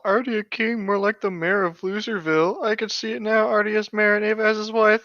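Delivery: lightly voiced smug knight; mocking Lancelot. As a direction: sneer it like a schoolyard bully. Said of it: "I heard Lancelot call".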